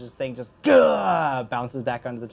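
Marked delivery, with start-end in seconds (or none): 0.6-1.4 loud "gah" of impact; higher, loud sound effect; imitated impact noise. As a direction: burst out a loud crunch noise.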